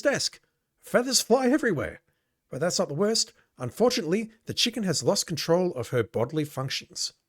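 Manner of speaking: animated narrator voice; game narration. A narrator's reading. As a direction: read the scene like a storyteller. Reads game narration aloud.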